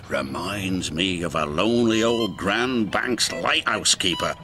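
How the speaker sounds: low, reminiscing sailor voice; old sea-dog yarn-spinner. A narrator's reading. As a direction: settle into an old salt's tale. Salty starts reminiscing in character.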